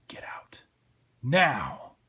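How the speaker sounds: hushed warning exploding into a loud command; small, then loud. Acted. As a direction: whisper it, then boom the last word. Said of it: "He wanted it to be small but then loud".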